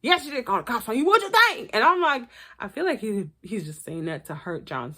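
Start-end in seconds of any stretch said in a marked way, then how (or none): 0.0-1.7 mock angry dad. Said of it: "Daddy John has said".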